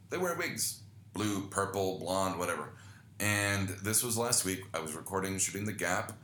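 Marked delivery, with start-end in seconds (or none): 0.1-0.8 "They wear wigs" jumping much higher on "wear"; mock disbelief. Shown as a demonstration. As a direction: repeat it in a much higher incredulous tone.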